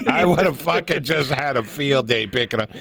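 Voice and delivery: ordinary delivery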